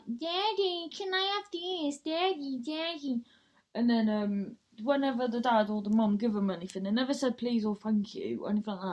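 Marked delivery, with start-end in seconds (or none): 0.1-3.2 put-on higher, whining child begging her dad; imitating a spoilt girl pestering her dad; whiny kid. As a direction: nag Daddy like a spoilt little girl. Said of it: "the woman's like".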